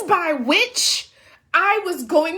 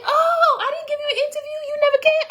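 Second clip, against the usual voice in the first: much higher, quick voice for the host; host's reply; 11 semitones higher, much faster than usual. Retold or imitated. Host says she never came, high quick voice. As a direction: play the host breezily, pitched up. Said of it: "he was like".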